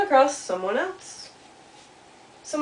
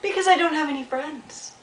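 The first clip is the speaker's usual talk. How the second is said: high, plaintive little beaver answer; plaintive call; 6 semitones higher than usual. Acted. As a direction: call the sad answer out over the water. Beaver calls his answer over pond.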